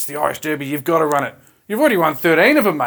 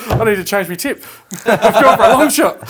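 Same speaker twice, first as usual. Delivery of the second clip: talking through real laughter, louder and higher; laughing; 5 semitones higher, 7 dB louder than usual. Real, not acted. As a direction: laugh while owning up to the tip. Laughing at the hosting bet.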